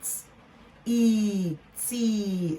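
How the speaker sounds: "T", long "e", then stretched "tea"; blending sounds. Shown as a demonstration. Teacher repeating the tea blend for learners, sounded out. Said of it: "let's blend them together".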